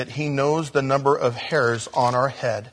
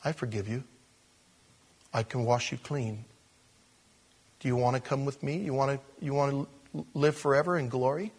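soft, tender, much quieter voice of the Lord; the Lord forgiving; 7 dB quieter than usual. Acted. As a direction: speak it gently as a forgiving God, almost hushed. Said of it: "and he says".

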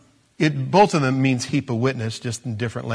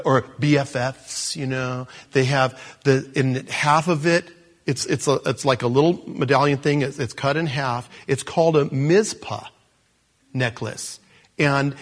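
ordinary talk

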